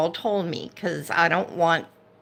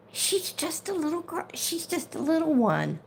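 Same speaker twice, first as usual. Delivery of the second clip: tender; 9 semitones higher, 5 dB quieter than usual. Real, not acted. Defending the little dog.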